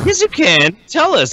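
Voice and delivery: high, eager coaxing; eager egging on. Real, not acted. Egging on a reluctant cohost.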